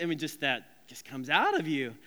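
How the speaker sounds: ordinary talk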